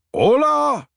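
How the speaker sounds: a high, loud hammed-up Spanish hola; bad Spanish accent. A narrator's reading. Grandfather answers the phone in a bad Spanish accent. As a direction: greet the caller with a hammy Spanish hola. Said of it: "in a bad Spanish accent".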